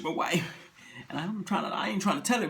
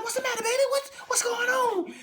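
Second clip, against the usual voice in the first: a wife's high worried coo. Acted. Wife voiced, worried.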